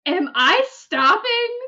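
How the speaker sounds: high, loud, laughing disbelief; incredulous disbelief. Retold or imitated. Player can't believe she's saving a drunk man, laughing disbelief.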